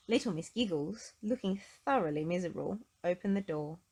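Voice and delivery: usual speaking voice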